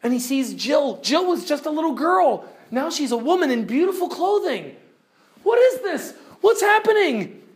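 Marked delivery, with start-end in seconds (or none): 5.4-7.4 startled. Acted. Tirian startled in retold story.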